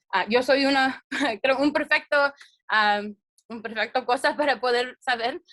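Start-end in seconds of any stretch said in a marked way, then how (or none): none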